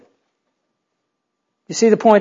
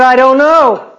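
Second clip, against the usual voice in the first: higher-pitched, lightly voiced lost girl; Alice; 6 semitones higher, 3 dB louder, slower than usual. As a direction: answer as a helpless little girl, pitched up. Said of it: "She said".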